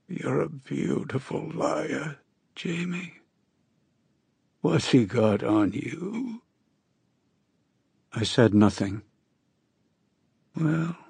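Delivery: high, slow hoarse voice of a sick woman; a dying woman's rasping plea. A narrator's reading. Narrator voices dying Astrid.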